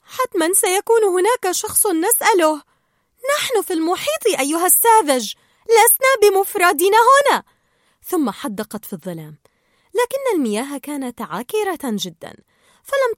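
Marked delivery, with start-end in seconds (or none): 0.0-7.4 high, loud, bubbly little fish insisting; chirpy Dory scolding her friend; Dory's voice. Acted. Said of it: "فقالت دوري". (صوت دوري العالي المتحمس)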